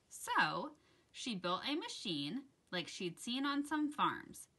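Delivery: put-on gentle rhyming read-aloud for children; soft, sing-song picture book reading; story narration. A narrator's reading. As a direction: read it like bedtime story time.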